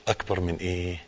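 ordinary delivery

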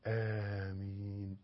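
آمين drawn out softly; slow soft آمين with a long first a; prayerful amen. Shown as a demonstration. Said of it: "اطلب".

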